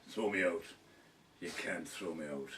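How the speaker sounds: a hint of a defiant old drunk; gravelly old Irishman. Storyteller imitating Foxy answering the landlord, mild defiant low old man. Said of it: "He had a very gravelly voice".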